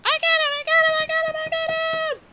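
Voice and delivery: tiny attacker shrieking very high and loud; high-pitched squeal. Acted. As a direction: squeal it out at the top of your range. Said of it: "you hear a high-pitched squeal".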